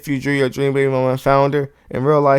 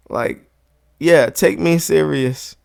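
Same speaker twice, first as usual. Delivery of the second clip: ordinary talk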